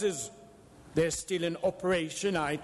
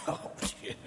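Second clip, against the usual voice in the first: breathy mocking laugh, much softer; a soft derisive laugh into the words; scornful laugh; 6 dB quieter than usual. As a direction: laugh it off under your breath. Scrooge mocks charity appeal.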